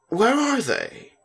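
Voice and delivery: put-on eager child's question; eager asking. A narrator's reading. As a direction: ask eagerly, expecting good news.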